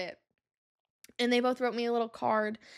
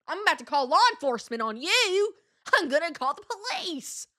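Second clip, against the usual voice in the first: mock threat; 4 semitones higher than usual. Mock threat to the old man.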